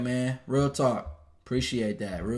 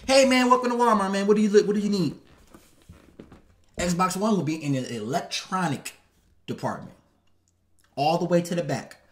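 higher, louder, cheery greeter voice welcoming a customer; code-switched store greeter; 5 semitones higher, 4 dB louder than usual. Retold or imitated. Streamer imitating a Walmart greeter for a customer, bright and upbeat. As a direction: play a chipper Walmart worker helping a shopper. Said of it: "so I know how to code switch".

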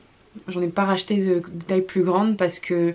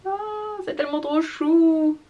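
cutesy cooing; 8 semitones higher than usual. Real, not acted. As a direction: melt over how cute it is. Gushing over cute baby outfit.

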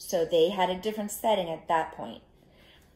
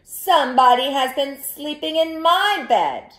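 loud, higher mama bear voice; medium-sized mama bear voice; 8 semitones higher, 11 dB louder than usual. A narrator's reading. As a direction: call it out as an indignant mama bear. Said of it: "she said in her medium-sized voice".

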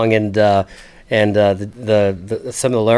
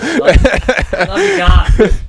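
high, giggly call out; laughing shout-out; 10 semitones higher, 4 dB louder, much slower than usual. Real, not acted. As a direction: laugh through a fond hello. Playful shout-out to the producer.

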